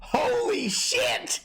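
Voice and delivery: high, drawn-out stunned exclamation; amazed. Real, not acted. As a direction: gasp it out in disbelief. Stunned by the tornado footage.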